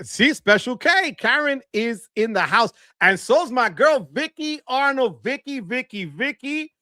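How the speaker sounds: high sing-song name calling; excited shout-out. Real, not acted. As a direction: draw the names out, playful and high. Hyping fans in chat.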